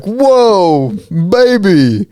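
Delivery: high, drawn-out gushing coo; imitated doting mom. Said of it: "she'll probably be like".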